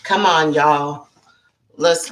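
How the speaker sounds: ordinary talk, nothing marked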